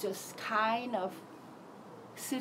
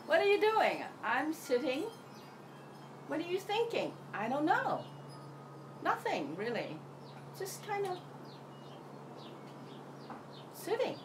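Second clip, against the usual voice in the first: family questioning; 7 semitones higher, 3 dB quieter than usual. Retold or imitated. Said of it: "They're like".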